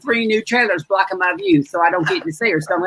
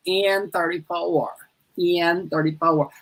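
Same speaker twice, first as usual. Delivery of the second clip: slow, quieter echo of the call; bingo call repeated; 4 dB quieter, much slower than usual. Acted. Bingo host repeating the ball softly. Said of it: "bingo calling".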